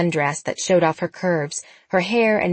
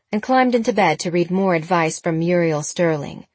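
usual speaking voice

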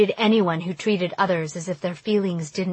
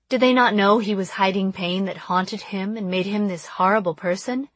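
plain reading voice

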